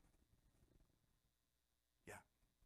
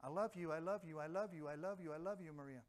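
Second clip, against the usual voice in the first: automatic i love you; 7 dB quieter than usual. Pretend. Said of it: "someone just automatically".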